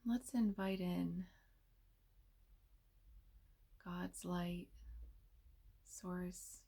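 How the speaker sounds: soft, unhurried meditation voice with long pauses; hushed, soothing delivery, leaving space between phrases; guided meditation. Real, not acted.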